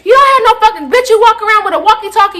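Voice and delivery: high, loud scornful rant; going off. Retold or imitated. Student mocking walkie-talkie authority, high angry rant. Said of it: "like, really going off".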